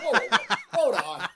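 loud, high, laughing whoa; laughing protest. Retold or imitated. Telling the birthday friend to hold on, laughing loud protest. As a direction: laugh while cutting her off.

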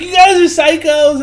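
high, loud imitated accusation; mock outraged yell. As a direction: shout it as the appalled captive. Acted quarrel, captive accusing them.

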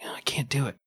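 hushed unvoiced no; whispering so nobody hears; whispered refusal. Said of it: "I'm like".

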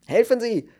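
high, loud, urgent plea; panicked cry for help; gasped alarm. A narrator's reading. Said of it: "keuchte er". (aufgeregt gekeucht)

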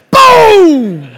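loud, high shouted boom sound effect; mock boom impact. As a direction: slam out a cartoon boom. Pillow hit like baseball bats.

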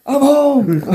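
high, hammed-up homecoming shout; triumphant shout. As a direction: belt it like a soldier back from war. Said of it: "He's like".

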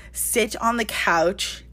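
ordinary talk, nothing marked

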